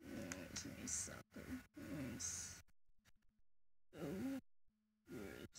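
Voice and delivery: a breathy hushed whisper. Real, not acted. Murmuring about the pacifier so the baby stays calm, whispered. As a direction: keep it to a whisper.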